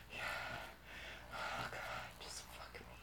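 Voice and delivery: very soft, unvoiced begging; hushed, intimate whisper; whispered plea. Real, not acted. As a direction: plead on breath alone.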